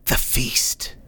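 breathy, airy whisper; whispered translation. Acted. Guide translating the creature's words for the cleric, whispering.

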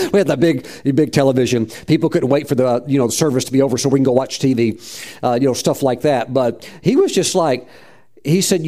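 usual speaking voice

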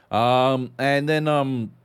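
ordinary delivery

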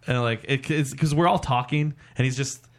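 normal talking voice

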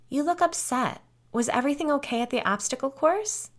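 high, lightly voiced sweet counselor; chirpy concern. A narrator's reading. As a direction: ask after him brightly, as if nothing happened. Killer turns chirpy and solicitous.

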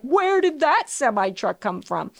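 mock-startled driver's outcry. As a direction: blurt it like a driver caught off guard. Acts out driver startled by horn.

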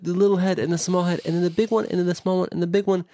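put-on high little-kid babble; imitating a kid chattering nonsense; child voice. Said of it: "I was talking about nonsense".

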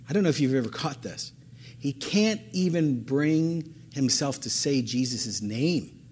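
usual speaking voice

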